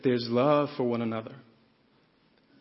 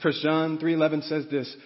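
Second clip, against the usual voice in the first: ordinary talk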